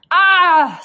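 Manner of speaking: high, loud scream of pain; sudden shriek. Acted. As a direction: scream as if bitten. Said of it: "screamed the thief".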